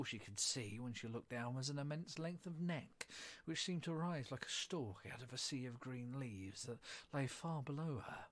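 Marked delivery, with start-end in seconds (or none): none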